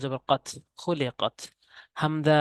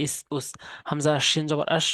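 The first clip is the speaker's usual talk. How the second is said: ordinary talk, nothing marked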